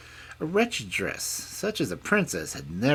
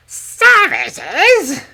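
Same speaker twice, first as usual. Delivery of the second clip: very loud, high, cross squawk; cross old hag; 20 dB louder than usual. A narrator's reading. Hag querying the princess's offer of services, crossly. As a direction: snap it as an outraged old hag. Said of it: "hag crossly".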